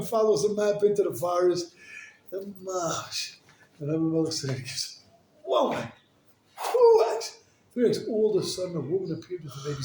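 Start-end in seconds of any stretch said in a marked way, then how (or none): 5.4-7.2 high, loud amazement; amazed at the sight, high and loud; amazed whoa. Retold or imitated. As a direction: gasp out the whoa in wonder. Amazed exclamation.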